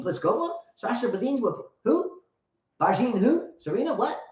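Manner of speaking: high incredulous who-is-that; a sarcastic questioning roll call; mock who. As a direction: pretend you've never heard of them. Mock forgetting faded players.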